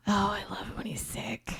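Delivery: very soft, breathed-out confession; hushed swoon, barely voiced; swooning whisper. Real, not acted. Wife admits loving husband's sick voice, swooning whisper.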